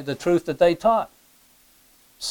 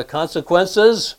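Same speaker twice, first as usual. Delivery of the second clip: ordinary delivery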